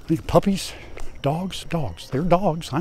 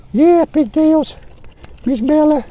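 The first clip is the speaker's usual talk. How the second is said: pitched way up and slow to talk to the pigs; high pig-talk voice; 12 semitones higher, slower than usual. Real, not acted. Farmer talking to his pigs in a high voice. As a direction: lift the voice high like calling sweet pigs. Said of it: "you gotta talk in a high voice".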